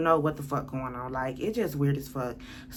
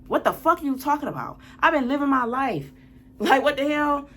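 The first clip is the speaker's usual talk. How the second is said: raised, higher-pitched angry retort; fed up, voice pitched up in indignation; exasperated; 8 semitones higher, 4 dB louder than usual. Real, not acted. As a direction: argue back at an ex in disbelief. Arguing back at ex's messages.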